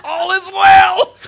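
high, loud, slow mock-cheerful cry; hammed-up forced cheer. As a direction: fake cheer, laughing through it. Forced cheer under pressure.

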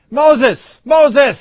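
high, loud summoning call. Acted. God calling Moses from the bush, high and loud. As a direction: call him out from afar.

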